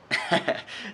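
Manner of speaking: a quick real chuckle at the punchline; short laugh. Real, not acted. Reviewer laughing at the book's joke, a short chuckle.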